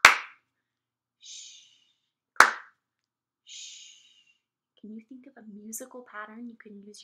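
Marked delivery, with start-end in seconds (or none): 1.2-1.6 soft "shh" as a beat; shushing. Shown as a demonstration. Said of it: "Or maybe you go clap". 3.4-3.9 soft "shh" as a beat; shushing. Shown as a demonstration. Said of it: "Or maybe you go clap".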